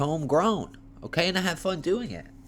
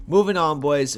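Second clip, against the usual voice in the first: louder, punchy "moving on"; loud announcement; 5 semitones higher, 6 dB louder than usual. Real, not acted. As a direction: call it out to move on. Loud transition.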